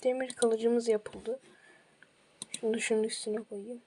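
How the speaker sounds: candy in the mouth; sucking a hard candy. Real, not acted. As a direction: talk around a hard candy. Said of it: "Ağzımda şeker var da".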